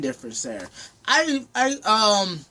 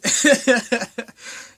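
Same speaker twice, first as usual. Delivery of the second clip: loud, higher-pitched burst of laughter; 4 semitones higher, 6 dB louder than usual. Real, not acted. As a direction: laugh out loud at your own attempt. Laughs at own accent attempt.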